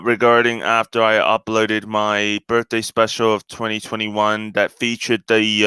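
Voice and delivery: ordinary talk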